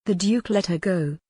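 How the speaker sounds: plain reading voice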